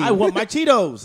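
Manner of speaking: bratty diner. Pretend. Playing a spoiled customer at a fancy restaurant, slow whiny demand.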